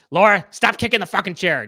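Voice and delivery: a hint of a fed-up dad; mock angry dad. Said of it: "if he was like".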